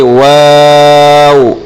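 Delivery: واو with rounded lips, drawn out. Shown as a demonstration. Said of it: "ہونٹوں کو گول کریں گے".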